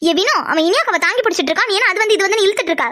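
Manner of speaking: impatient scolding. Acted. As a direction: chide a friend for dithering. Scolds friend for hesitating.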